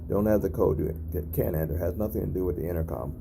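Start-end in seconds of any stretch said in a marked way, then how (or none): none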